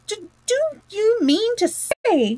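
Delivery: high, drawn-out, stammering disbelief; excited. A narrator's reading. Excited rat questions mole about the doormat, incredulous. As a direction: stumble in, pitch leaping with excitement. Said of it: "cried the excited rat".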